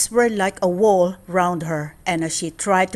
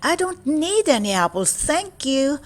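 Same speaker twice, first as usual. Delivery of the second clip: put-on higher girlish refusal; polite little girl; 8 semitones higher than usual. A narrator's reading. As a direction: politely decline the apples.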